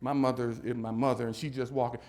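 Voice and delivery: ordinary talk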